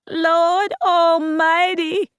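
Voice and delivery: slow, high, loud wail; mother wailing over the dead son-in-law. Acted. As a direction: draw it out in a grieving wail. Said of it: "she wailed".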